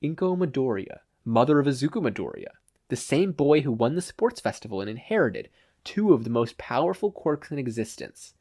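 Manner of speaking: ordinary delivery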